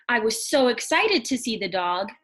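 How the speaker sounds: a touch of excitement on excited. Shown as a demonstration. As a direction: read it like you can't wait to see the dog. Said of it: "that shows emotion".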